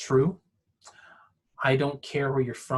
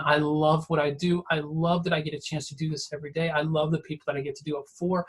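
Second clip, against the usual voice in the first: ordinary talk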